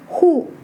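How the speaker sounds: "hu" falling sharply from high; fourth tone. Shown as a demonstration. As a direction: drop "hu" briskly from the top. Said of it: "but have different tones".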